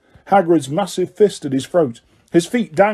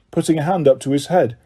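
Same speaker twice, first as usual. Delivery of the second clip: usual speaking voice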